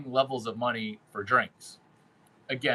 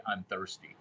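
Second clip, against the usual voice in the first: quiet; 6 semitones lower, 9 dB quieter than usual. Shown as a demonstration. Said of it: "all I do is say".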